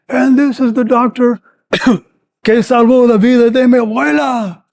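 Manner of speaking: high, loud rally cry; a villager hailing the doctor, high and loud; villager shouting. Retold or imitated. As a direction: shout his praise to the crowd.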